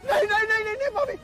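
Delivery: panicked crying no. Acted. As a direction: sob out the refusal. Panicked refusal.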